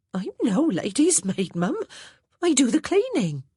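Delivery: put-on a plain-spoken old housemaid reporting orders; Hattie the maid's voice. A narrator's reading.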